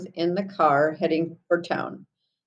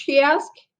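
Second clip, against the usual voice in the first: usual speaking voice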